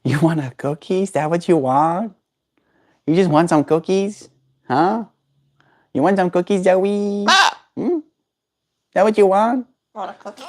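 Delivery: put-on baby talk to a pet. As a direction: coax the bird like a toddler.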